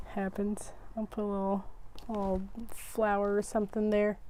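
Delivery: soft, almost to herself; quiet aside. Real, not acted. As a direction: murmur it after a laugh. Laughing off a mistake quietly.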